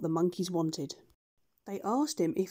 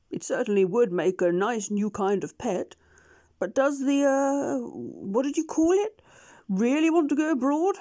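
hesitant doctor; 5 semitones higher, 5 dB louder than usual. Acted. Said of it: "mumbled the doctor".

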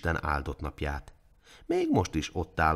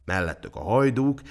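plain reading voice

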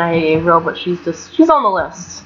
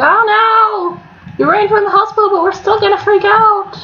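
mocking the Sims; 10 semitones higher than usual. Gamer mocking sims freaking out at the pregnancy, high squeal. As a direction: squeal like a silly panicking crowd. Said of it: "and they're like".

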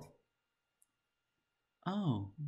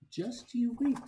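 much higher, bursting with glee; excited promise; 11 semitones higher than usual. Acted. Reader promising the kid fun, high and excited. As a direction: let the second one pop up high.

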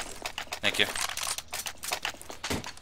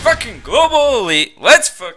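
much higher, loud triumphant yell; excited victory shout; 12 semitones higher, 10 dB louder than usual. Real, not acted. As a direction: shout it out after winning. Celebrating the win.

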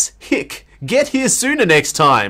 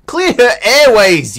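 slow, louder command; bossy; 6 semitones higher, 6 dB louder, slower than usual. Real, not acted. Player barking at Satoshi to clear her airways, slow and loud. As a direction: order it out slowly.

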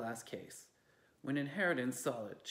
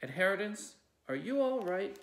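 concerned call; 6 semitones higher, 6 dB louder than usual. Acted. Aunt calls out concerned.

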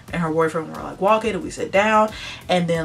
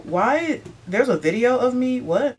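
high-pitched incredulous aside; thrown-off, questioning interjection; baffled reaction; 7 semitones higher than usual. Retold or imitated. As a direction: jump up in disbelief at the accusation. Baffled aside at manager's accusation.

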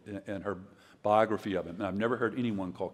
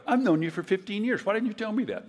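high, incredulous retold question; playing his own amazement, pitched up; mock astonishment; surprised; 11 semitones higher, 4 dB louder than usual.

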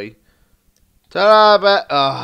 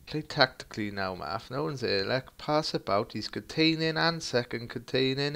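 ordinary talk

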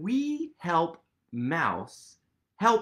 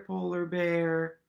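ordinary delivery

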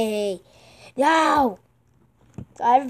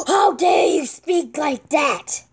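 outraged cry; 4 semitones higher, 4 dB louder, slower than usual. Acted. Outraged at a chat comment.

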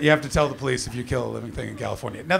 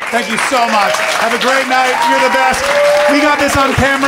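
loud, rising higher as it builds; shouted sign-off; 9 semitones higher, 9 dB louder than usual. Real, not acted. Comedian thanking the crowd at show's end, loud building shout. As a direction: call out to the whole crowd, building to a high shout.

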